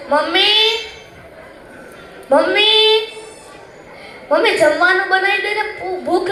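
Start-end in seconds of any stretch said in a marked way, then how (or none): 0.1-3.1 calling out. Acted. Child home, calls for mother.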